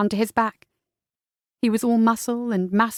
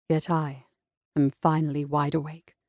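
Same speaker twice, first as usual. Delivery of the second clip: low and quiet; soft, low tender reply; said quietly; 7 semitones lower, 6 dB quieter than usual. A narrator's reading. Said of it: "he said quietly".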